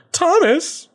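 high, drawn-out "Thomas"; exasperated Robert. Retold or imitated. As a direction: groan his name in high disbelief. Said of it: "Robert's gonna be like".

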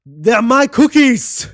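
loud, high, drawn-out film quote; hammed-up roar, stretched out slow; mock outraged shout. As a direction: bellow it like a hammed-up movie line.

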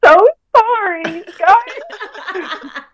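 hammed-up tearful wail; mock sobbing apology. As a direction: whimper it like a crying queen. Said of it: "I'd be like".

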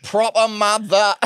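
high-pitched attempt at Mancunian gone Geordie; botched Manc accent, Geordie-sounding. Joining in the zombie bit with a failed Mancunian accent. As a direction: do a bad Manc that slips into Geordie. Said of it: "What accent was that? You were Geordie".

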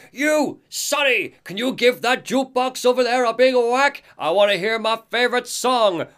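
put-on high, strained old-timer voice; Odin, cranky old man. Odin ordering Alan to whack the jukebox, high old-timer voice. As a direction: holler it high like a demanding old codger. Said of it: "he looks at Alan and he's like".

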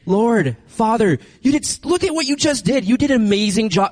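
excited praise prayer. Retold or imitated. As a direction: gush thanks to God like a thrilled child. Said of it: "and I'll be like".

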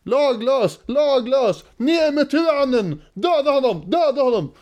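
high, strained cries at ordinary volume; mock roaring mob. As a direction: imitate an outraged crowd chanting. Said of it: "Och det vrålas".

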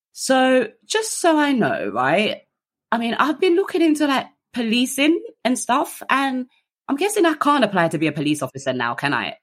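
sheepish hopeful question. Retold or imitated. Naive question to officers in van.

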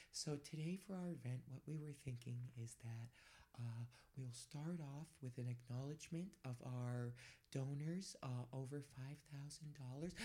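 barely audible event speaker, far off the mic; softly speaking. Retold or imitated. Event host thanking donors off mic, speaking very softly. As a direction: imitate a timid speech given away from the microphone. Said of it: "they're just gonna softly speak".

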